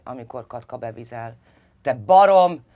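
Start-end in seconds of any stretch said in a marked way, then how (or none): 1.8-2.6 furious shout; enraged father screaming; yelling. A narrator's reading. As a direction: bellow the insult at her. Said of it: "üvöltött rá".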